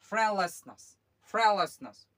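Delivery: फ्रेंडलेसनेस called out loud, a bit high; loud model word. Shown as a demonstration. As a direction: announce फ्रेंडलेसनेस loudly for the class. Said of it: "model word for class". (फ्रेंडलेसनेस ज़ोर से, class को सुनाते हुए)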